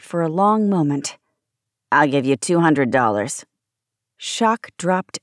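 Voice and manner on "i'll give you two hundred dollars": low smoker's growl; curt, low-pitched pawnbroker rasp; two-packs-a-day growl. A narrator's reading. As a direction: growl like a chain-smoking pawnbroker. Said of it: "two packs a day camel's voice".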